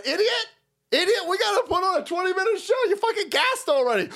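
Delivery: high yelled wrestler rant; mock angry wrestler. Wrestler yelling at his tired partner, high mock anger. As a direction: chew out a gassed partner. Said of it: "somebody in the ring going".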